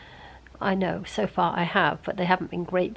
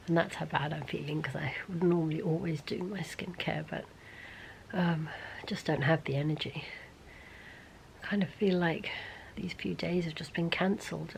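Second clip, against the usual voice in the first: drained and poorly; 8 dB quieter than usual. Real, not acted. Ill vlogger admitting she has no energy, quiet and drained. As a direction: speak quietly, worn down by being ill.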